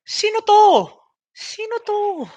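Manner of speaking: high, loud incredulous cry of who is this; yelling in disbelief at an unknown pick; shocked disbelief. Retold or imitated. Streamer yelling in shock at an unknown draft pick, high and loud. Reenacting his live reaction.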